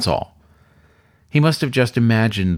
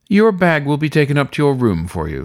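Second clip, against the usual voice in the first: courteous weasel host; 6 semitones higher, 4 dB louder than usual. Acted. Weasel host offers service.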